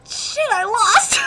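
high, drawn-out cry; hammed-up losing yell; acted wail of defeat. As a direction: wail it slowly in mock defeat. Acts out character's outburst.

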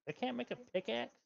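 ordinary talk, nothing marked

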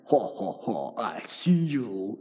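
low, slow spooky taunt; lightly creepy villain laugh. Pretend. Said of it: "in a creepy voice".

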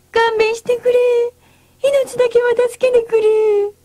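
high, loud, desperate begging; pleading for mercy. A narrator's reading. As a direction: beg for your life in terror. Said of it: "泣きながら謝った". (命乞いする怯えた声)

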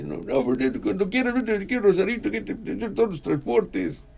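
a high, halting imitation of a friendly old driver; Amaro Mata's voice.

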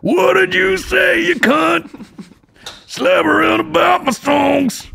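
high, slow shouted rocker voice. Pretend. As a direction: belt it out like a gravelly rock star. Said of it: "my spot-on Nickelback impression".

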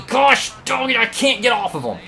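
high, loud yell of real frustration; exasperated outburst. Real, not acted. Player stuck on Newman's car, exasperated yell. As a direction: blurt it out high, at wits' end.

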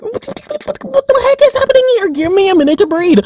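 high, fast flustered what-what; overwhelmed. Retold or imitated. Fan overwhelmed by the movie's emotions, flustered and high. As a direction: sputter it, totally overwhelmed. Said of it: "and I'm like".

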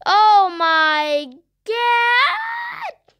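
slow, drawn-out loud cry of shock; startled shout. Real, not acted. Gamer startled by the game, a drawn-out shout. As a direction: let the shock stretch out loud.

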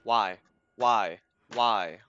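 panicked yelling while fleeing; being chased. Real, not acted. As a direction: shout in frantic despair.

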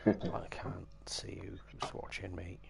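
slow, very soft whisper. Real, not acted. Streamer wondering who is watching, whispered. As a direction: whisper it slowly, drawn out.

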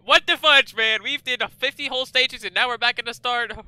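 loud exasperated high complaint; frustrated yelling. Real, not acted. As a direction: rant in disbelief. Game reset to start.

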